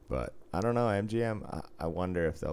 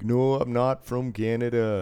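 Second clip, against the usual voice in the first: higher, louder mock Minnesota accent; 5 semitones higher, 5 dB louder than usual. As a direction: protest in a hammed-up Minnesota accent. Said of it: "like, Minnesota's fucking awesome".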